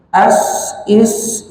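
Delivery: as and is with a long sharp hiss. Shown as a demonstration. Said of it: "bacanya dengan berdesis". (desis tajam pada as, is)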